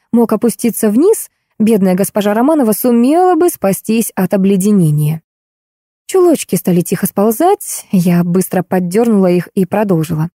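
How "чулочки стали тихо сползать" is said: ordinary delivery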